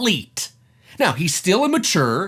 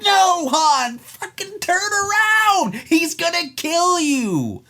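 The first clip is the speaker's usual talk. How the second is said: pleading yell at the screen; 10 semitones higher, slower than usual. Retold or imitated. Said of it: "I'm like".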